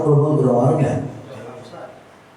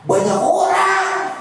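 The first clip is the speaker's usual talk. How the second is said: high, drawn-out call of a mother; mock mother call; slower than usual. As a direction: call out like a mom from the door. Mother calls out in story. (meniru panggilan emak)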